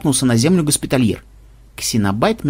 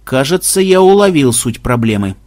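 plain reading voice

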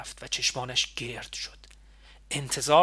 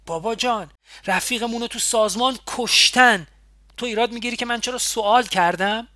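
raised, heated voice, much higher and louder; angry outburst; 7 semitones higher, 7 dB louder than usual. A narrator's reading. Woman scolding her comrade over the killed friend, angry and loud. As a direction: snap back at him in rising anger. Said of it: "با غیظ گفتم".

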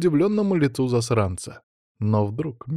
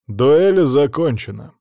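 loud, slow declaration; formal announcement; 3 semitones higher, 5 dB louder, slower than usual. A narrator's reading. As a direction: declare it slowly with authority. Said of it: "объявил граф Краснов".

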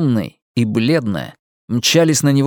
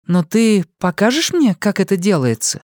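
put-on eager young woman; 9 semitones higher than usual. A narrator's reading. Young woman warms to the motorcycle plan, eager. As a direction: let her brighten at the idea.

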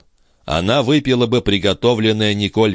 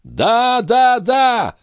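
very high impassioned yell; wailing cry; much slower than usual. A narrator's reading. Brother vows to kill the attacker, high impassioned cry. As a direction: cry each word out on a high pitch. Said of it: "возопил молодой человек".

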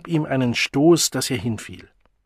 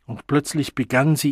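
usual speaking voice

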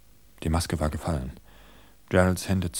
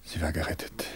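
hushed relief; 3 semitones lower, 5 dB quieter than usual. A narrator's reading. Relief after danger, narration.

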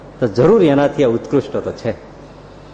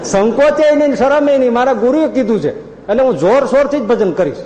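higher, louder, insisting on the guru's word; stubborn loud insistence; 8 semitones higher than usual. Retold or imitated. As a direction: refuse the family, voice raised. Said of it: "જોર જોરથી બોલે". (ઊંચા અવાજે જીદથી)

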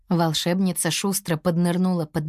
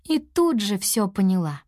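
plain reading voice

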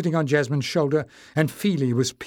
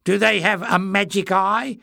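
high mocking sneer; mock him with a sneer; 7 semitones higher, 4 dB louder than usual. Clef taunting Warren, high and sneering. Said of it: "Clef sneered".

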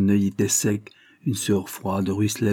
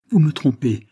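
higher, louder outcry of accusation; crying out; 6 semitones higher, 6 dB louder than usual. A narrator's reading. Daughter accusing the lawyer of deceiving her, crying out. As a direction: cry it out as an anguished accusation. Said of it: "s'écria-t-elle".

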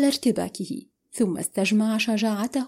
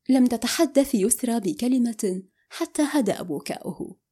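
ordinary delivery

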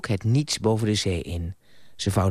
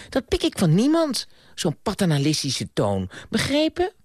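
higher, sharp indignant flare; flaring up; 10 semitones higher than usual. A narrator's reading. Ardita snapping at Carlyle, indignant and higher. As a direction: she bristles at his fatherly tone, rising higher. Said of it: "vloog Ardito op".

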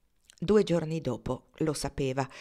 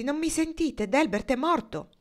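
high-pitched, exasperated urgency; insistent; 9 semitones higher, 4 dB louder than usual. A narrator's reading. Foxy pressing news on Marnie who ignores him, insistent. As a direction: push to be heard, pitch shooting up. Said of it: "insistette Foxy".

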